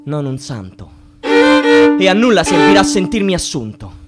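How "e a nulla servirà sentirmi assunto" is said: bitter declamation. Acted. Worker rejecting any share, bitter declamation. Tirade climaxes on nothingness.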